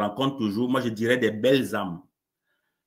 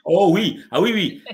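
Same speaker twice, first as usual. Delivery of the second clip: bright, higher, louder burst of yes; eager agreement; 7 semitones higher, 7 dB louder than usual. Real, not acted. Agrees he is very busy.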